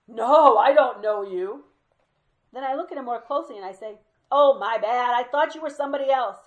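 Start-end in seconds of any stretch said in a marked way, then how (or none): 0.1-1.6 loud mock refusal. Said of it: "And he shakes his head". 4.3-6.4 much louder and higher, booming at the checkout; loud storytelling. Retold or imitated. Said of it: "loud-ass voice".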